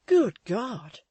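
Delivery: loud shocked cry; cried out. A narrator's reading. Walter hearing Paul is ill, loud shocked cry. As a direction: gasp it out loud. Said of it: "cried Walter".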